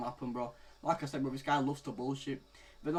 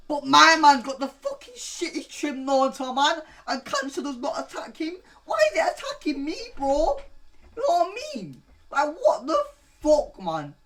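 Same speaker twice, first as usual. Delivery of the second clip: much higher, louder, squeaky exasperated whine; stressed rant; 12 semitones higher, 10 dB louder than usual. Real, not acted. To viewers about his balding, a stressed high-pitched rant. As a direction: complain in a strained high voice. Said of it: "I'm actually so stressed out".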